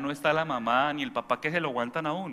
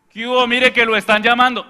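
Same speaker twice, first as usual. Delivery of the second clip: loud, high call; yelling; 8 semitones higher, 14 dB louder than usual. Retold or imitated. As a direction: yell that the phone is for them. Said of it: "No, ir hasta la persona".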